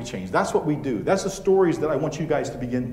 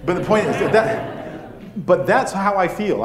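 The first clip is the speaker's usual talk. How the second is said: laughing through the words, louder; 4 semitones higher, 5 dB louder, slower than usual. Real, not acted. Speaker wraps up the son joke, still laughing. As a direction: get back to the point while still laughing.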